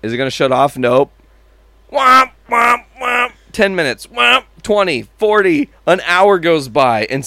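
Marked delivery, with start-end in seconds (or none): 1.9-3.3 high, loud honking womp; imitated car horn. Mimicking the neighbor's stuck car horn, loud and high. 4.1-4.4 high, loud honking womp; imitated car horn. Mimicking the neighbor's stuck car horn, loud and high.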